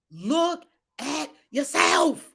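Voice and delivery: slow, word-by-word command; emphatic command. Real, not acted. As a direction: spell it out slowly, each word separate. Emphatic command, then quiet self-application.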